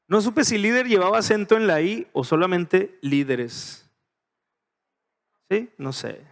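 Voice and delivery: ordinary talk, nothing marked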